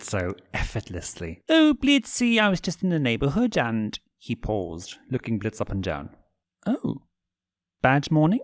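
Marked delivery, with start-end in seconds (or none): 1.5-4.0 much higher, sing-song prince voice; owl prince voice. Acted. Stolas greeting Blitz in his office, high and sing-song. 6.7-8.4 high, sympathetic owl voice; concerned prince. Acted. Stolas noticing Blitz's bad mood, high and sympathetic.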